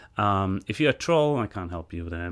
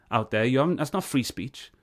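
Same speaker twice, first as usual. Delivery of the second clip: normal talking voice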